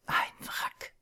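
barely voiced, half-breathed words; whisper to himself. Man staring at his ruined reflection, whispering to himself. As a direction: whisper it to yourself like a broken man. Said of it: "flüsterte ich mir selber zu".

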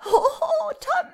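very high, loud goblin cackle; squealing, gleeful imp voice; shrill laughing creature. A narrator's reading. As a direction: crow shrilly with mocking laughter. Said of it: "brach in ein schrilles Lachen aus".

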